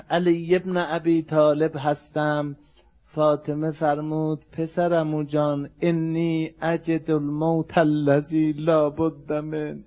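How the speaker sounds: low, softened rawzeh voice; subdued mourning recitation. Acted. Voices dying Fatima's farewell.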